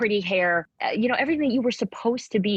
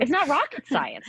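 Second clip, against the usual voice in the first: exasperated; 9 semitones higher than usual. Real, not acted. Exasperated at her old self.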